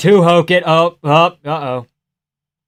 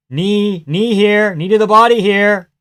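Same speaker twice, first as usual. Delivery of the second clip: raised pitch, building louder urging; urgent calling out; 7 semitones higher, 5 dB louder, slower than usual. Real, not acted. Viewer urging a knee to the body, urgent calling. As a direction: insistently call for the strike.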